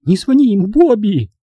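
high, loud, desperate begging; hoarse plea. A narrator's reading. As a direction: plead with him in a high woman's voice. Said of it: "осипшим голосом сказала Эдвина".

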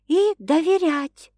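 put-on high, slow girl's voice adding a condition; pointed reminder. A narrator's reading. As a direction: tack it on knowingly. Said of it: "напомнила девушка".

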